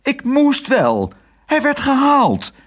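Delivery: very high, drawn-out protest; flustered nurse defending herself; crying out. A narrator's reading. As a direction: plead your defence in a shrieky high pitch. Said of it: "riep zuster Snijbiet".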